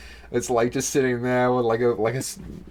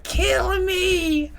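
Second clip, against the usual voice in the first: dog's plea. Retold or imitated. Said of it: "he'd be like".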